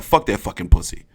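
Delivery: a low, quiet impression of the boxer; Harrison impression. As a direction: mutter it low like a fighter spoiling for a fight. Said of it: "Tony Harrison just woke up like".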